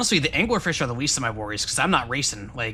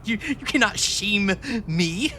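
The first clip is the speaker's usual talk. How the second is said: slow, high-pitched grand declaration; playfully unshameable; mock defiance; 5 semitones higher, slower than usual. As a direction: draw it out with hammed-up pride. Mock-defiant retort to being called coward.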